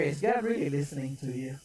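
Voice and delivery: low, hushed tempter's voice; insinuating whisper. Acted. Satan whispers doubt to someone praying, low insinuating murmur. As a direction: drop low and soft, planting doubt in the ear. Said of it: "he's gonna whisper in your ear".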